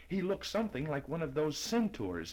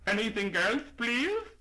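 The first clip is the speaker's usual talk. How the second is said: high, loud polite shopkeeper asking; brisk shop-counter voice, pitched up and raised; 9 semitones higher, 6 dB louder than usual. Acted. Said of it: "lifted his voice to an ear-splitting shout".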